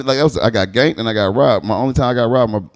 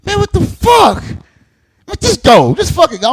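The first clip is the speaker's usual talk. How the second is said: much higher, louder, fed up; exasperated yell; 6 dB louder, much slower than usual. Retold or imitated. The victim counting change, yelled at to just go. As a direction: shout at him to just go. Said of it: "I'm like".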